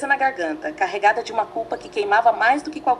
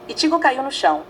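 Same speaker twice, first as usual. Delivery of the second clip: ordinary delivery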